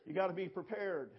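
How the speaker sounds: forceful preaching refrain; emphatic refrain. Acted. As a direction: drive the point home. Refrain said louder.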